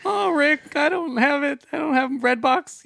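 very high, whiny Morty impression; squeaky nervous teen. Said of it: "Morty's like".

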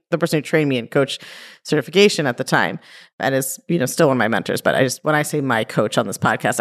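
ordinary delivery

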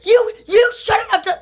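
high crying shout; mom yelling. Retold or imitated. Mom yelling at dad, high tearful shout. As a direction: yell it high through tears. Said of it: "my mom's like".

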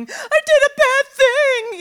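put-on very high, loud, slow childish wail; crying like a child. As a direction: blubber like a guilty kid. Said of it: "start immediately crying and going".

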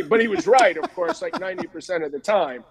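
usual speaking voice

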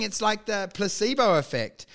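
normal talking voice

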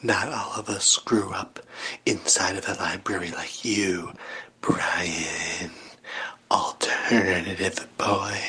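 breathy mock whisper. Whispering a jab at Brian. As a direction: hiss it low and secret.

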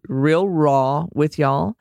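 slow Texas drawl on "raw" and "y'all"; Texas accent. Real, not acted. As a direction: stretch "raw" into extra syllables, Texas style. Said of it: "don't you love my Texas accent".

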